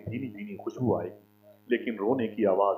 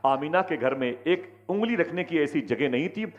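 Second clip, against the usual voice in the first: full-force preaching; 4 semitones higher than usual. Acted. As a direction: proclaim it to the whole crowd. (ज़ोरदार तक़रीर)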